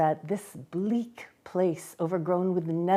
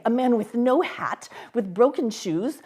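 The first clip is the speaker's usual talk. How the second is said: ordinary delivery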